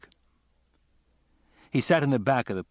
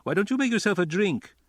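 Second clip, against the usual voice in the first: voiced hostess; 11 semitones higher, 4 dB louder than usual. A narrator's reading. Hostess offers Angela a drink.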